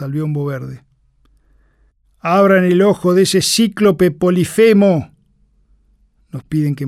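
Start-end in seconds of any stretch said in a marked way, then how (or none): 2.2-5.1 slow, loud, higher mocking command; mocking doctor. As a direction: boom it out like a leering army doctor. Military doctor's crude order.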